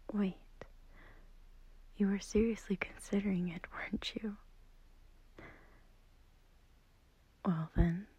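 whispering. Acted. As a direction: whisper so the sleeper beside you doesn't wake. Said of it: "I'm whispering because I don't want to wake up".

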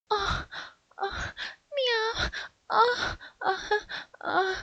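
high, mewling, building gasps toward climax; climbing moans. Acted. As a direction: let the meows rise out of control. Nearing climax, moaning.

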